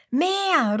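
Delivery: hoher, lauter Jubelruf; fröhlich nach mehr verlangen; vergnügter Ruf. A narrator's reading. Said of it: "ruft Leon und klatscht vergnügt". (Leon ruft vergnügt nach dem nächsten Witz)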